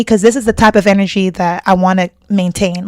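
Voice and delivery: normal talking voice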